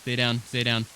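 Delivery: loud urgent warning; urgent shout. Real, not acted. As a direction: shout it to a pinned teammate. Under sniper fire, warning teammate.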